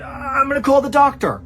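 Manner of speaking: imitating a panicked wife, high and loud; retold panic. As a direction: blurt it out high, like an alarmed wife. Wife panics over bleeding husband.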